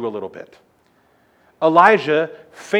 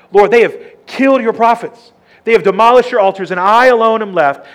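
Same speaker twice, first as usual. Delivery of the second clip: desperate complaint; 7 semitones higher, 9 dB louder than usual. Acted. Elijah's desperate complaint to God.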